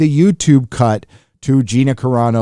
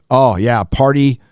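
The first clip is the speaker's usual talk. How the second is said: ordinary talk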